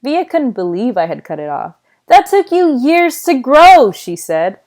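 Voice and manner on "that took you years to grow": high, loud, drawn-out outrage; almost angry. A narrator's reading. Sister sees the braid is cut off, almost angry. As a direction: scold slowly at a raised pitch. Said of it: "almost like she was angry".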